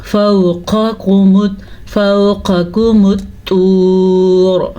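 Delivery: slow, drawn-out tajweed recitation; Quranic recitation. Shown as a demonstration. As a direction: recite slowly and melodically. Recites verse. (धीमी तिलावत)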